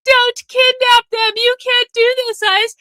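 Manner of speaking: high, slow mocking protest; hammed-up high-pitched outcry of a protester; mock bleeding-heart liberal. Streamer mimicking liberals surrounding ICE, mock outraged plea. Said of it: "bleeding heart liberals start surrounding them and going".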